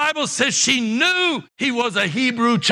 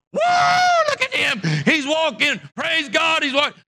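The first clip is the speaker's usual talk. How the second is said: amazed praise; 6 semitones higher than usual. Pretend. Leaders imagined marveling at healing.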